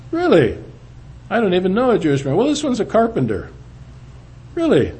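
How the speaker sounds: high, quick disbelief; retold astonished reaction; incredulous. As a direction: fire back in amazed surprise. Incredulous replies retold in dialogue.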